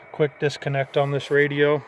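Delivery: ordinary talk, nothing marked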